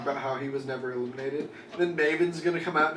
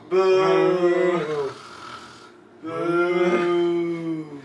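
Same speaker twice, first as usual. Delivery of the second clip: booing; 3 semitones higher, 6 dB louder than usual. Retold or imitated. Imitating crowd booing.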